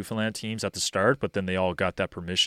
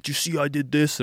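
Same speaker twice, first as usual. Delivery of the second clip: higher-pitched imitation of an eager kid; imitated eager kid; 7 semitones higher, 3 dB louder than usual. Kid showing coach a move, imitated eagerness. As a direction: mimic a kid seeking approval. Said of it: "they'll come up to me and be like".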